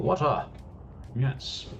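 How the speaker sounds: ordinary talk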